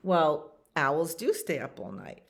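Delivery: ordinary delivery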